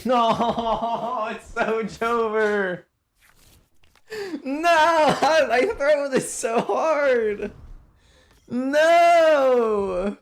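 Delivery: high, stretched-out wail of defeat; anguished wail. Real, not acted. Gamer realising the game is thrown, anguished wail. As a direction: wail it high, dragging out each "no".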